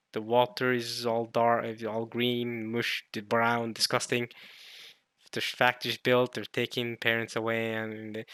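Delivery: usual speaking voice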